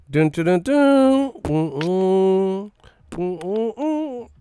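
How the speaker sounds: hummed suspense theme, loud and high; dramatic music imitation. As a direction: sing the dun dun dun sting.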